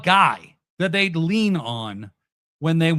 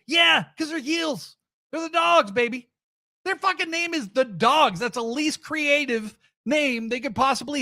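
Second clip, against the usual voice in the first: excited; 9 semitones higher than usual. Real, not acted. Defending the Dogs, worked up.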